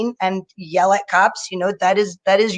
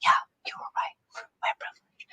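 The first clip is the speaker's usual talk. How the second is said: friend's whispered admission; hushed conceding whisper; 11 dB quieter than usual. Retold or imitated. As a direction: whisper it back in agreement. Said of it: "And he's like".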